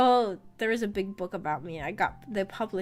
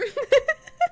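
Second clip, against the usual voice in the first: high giggle; 8 dB louder than usual. Real, not acted. Laughing at imagined comments.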